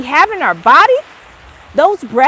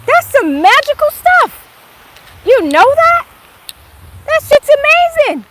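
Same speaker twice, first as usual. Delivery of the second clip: excited; 10 semitones higher, 7 dB louder than usual. Acted. Raving about magical things.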